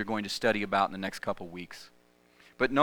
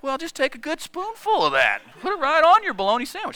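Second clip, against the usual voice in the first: much higher, louder sly grandpa voice; grandfather's voice; 5 dB louder than usual. Retold or imitated. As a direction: goad the boy on like a mischievous grandpa. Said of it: "He's like".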